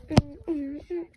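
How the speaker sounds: ordinary talk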